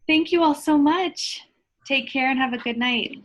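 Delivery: high, lifted goodbye to everyone; bright farewell. Real, not acted. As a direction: sign off with cheer. Cheerful sign-off to audience.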